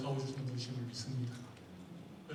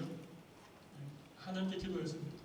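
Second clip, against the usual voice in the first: ordinary talk, nothing marked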